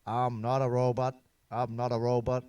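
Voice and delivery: lightly voiced robot; a hint of a robot chanting.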